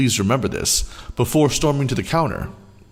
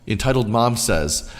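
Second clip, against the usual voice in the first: plain reading voice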